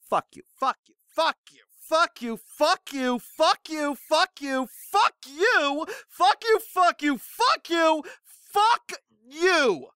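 high-pitched petulant tantrum, repeated over and over; hammed-up anger. Angry poster answering someone who doubts him, hammed-up tantrum. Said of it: "I'm so angry".

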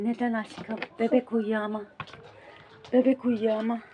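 soft hushed voice; quiet at night. Real, not acted. Hushing, baby asleep.

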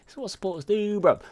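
high, pumped-up brag; excited brag. Real, not acted. A support player hyping his own good play in a high, excited voice. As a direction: crow it up high and proud.

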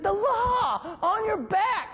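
high, slow, drawn-out shout; frantic shout. Retold or imitated. Frantic sergeant shouting an order across the street. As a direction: yell it across the fire, stretched out.

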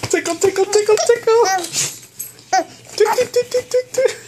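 very high squeaky giggly tickling voice; giggly tickle attack. Real, not acted. Dad tickling giggling baby.

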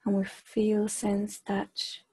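measured meditation voice with long, unhurried pauses; guided meditation. Acted. Leader guiding a group to extend their awareness, guided meditation. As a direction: guide them gently inward, phrase by phrase, softening as it goes.

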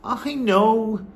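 put-on high, exasperated boy; crying out. A narrator's reading. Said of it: "I cried".

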